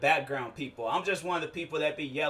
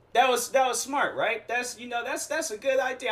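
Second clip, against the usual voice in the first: sarcastic; 8 semitones higher than usual. Real, not acted. Sarcastic praise of dumb idea.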